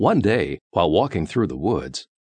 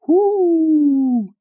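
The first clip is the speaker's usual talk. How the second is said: long, high, loud "whoo"; louder hoot; 6 dB louder than usual. A narrator's reading. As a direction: answer with a mysterious high hoot. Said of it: "answered the voice a little louder".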